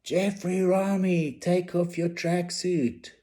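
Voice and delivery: high, slow called-out taunt; a mocking shout at the swimmer; shouting across the pool. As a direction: yell it across the gala to get a laugh. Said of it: "I shouted".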